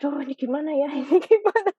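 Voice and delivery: high-pitched mock panic, laughing; retold fluster. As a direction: act out being at a loss, with a laugh. Retells panic at being intimidated. (pura-pura panik sambil tertawa)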